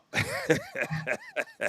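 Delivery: high, soft chuckling laugh; real high-pitched giggle; amused laugh. Real, not acted. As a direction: laugh quietly at your own joke. Laughing after joking donation plug.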